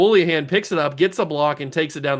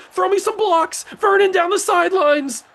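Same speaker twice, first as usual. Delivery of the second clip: very high, loud play-by-play shout; frantic excited call; 14 semitones higher, 6 dB louder than usual. Real, not acted. Player calling his team's fumble return, frantic shouting. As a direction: scream the fumble return as it unfolds.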